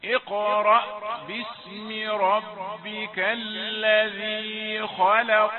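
slow, melodic, sustained chanting; Quranic recitation. Acted. Teacher recites the sura for students to follow, slow chanting. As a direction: chant each verse slowly and let it ring.

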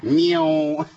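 ميو with a heavy nasal hum; mock the too-strong nasal sound ميو; imitating an exaggerated ghunna on ميو; overstrong ghunna. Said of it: "زي الشيخ عبد الرحمن ما عملها كده".

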